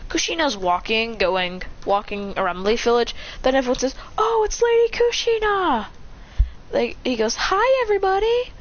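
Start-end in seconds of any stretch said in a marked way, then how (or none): 4.2-5.9 high-pitched villagers' cheer; excited greeting. Acted. 7.3-8.5 high-pitched sing-out hello; very high, bubbly acted greeting; cheery greeting. Kushina greets the villagers.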